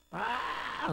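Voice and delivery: high, soft mock scream; drawn-out put-on wail; imitated scream. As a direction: imitate a long shriek without full volume. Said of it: "소리 지르고 막 발구르기도 하고".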